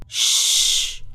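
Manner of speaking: sharp hush; shushing. Acted. Rashed shushing Noah. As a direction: cut him off with a hiss.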